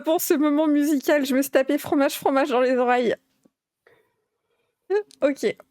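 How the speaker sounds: ordinary talk, nothing marked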